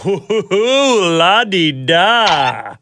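slow, fancy "la-di-da"; mock posh. Host teasing colleague about fancy Pfizer, mock posh drawl. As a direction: tease like it's a luxury brand.

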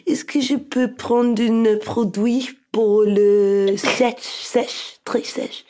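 high, slow French, fading to a breath on très sèche; speaking French. Retold or imitated. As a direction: mimic her own halting pharmacy French. Retells asking French pharmacist.

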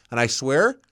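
usual speaking voice

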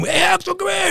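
high, called across the track; calling out. Retold or imitated. Dad calling his son over from across the track, high call. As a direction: shout the kid's name from far off.

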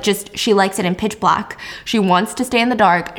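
normal talking voice

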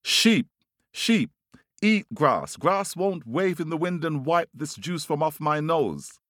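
high, insistent storybook bird voice; calling out. A narrator's reading. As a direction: order them about in a high-pitched call. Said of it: "he says to the sheep".

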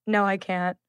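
low, soft deadpan no; straight-faced refusal. Retold or imitated. Contestant refusing the rose, straight-faced. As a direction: turn it down softly with a straight face. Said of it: "just so straight-faced".

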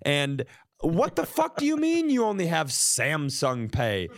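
ordinary talk

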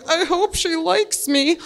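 high, slow, timid little voice; nervous guy voice. Retold or imitated. High, slow voice of the nervous guy she brought home. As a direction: play the shy nervous guy hoping she likes you. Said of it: "He is just like".

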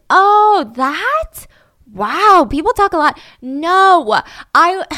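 high, loud drawn-out mock surprise; airy, hammed-up brush-off when caught out; exaggerated dismissiveness. [name] explaining away her lie, exaggeratedly dismissive.

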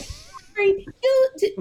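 high-pitched scolding cut short mid-word; high nagging mother. Retold or imitated. Mother nagging her teenage son, high and scolding. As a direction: nag him in a high mom voice. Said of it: "he don't like that".

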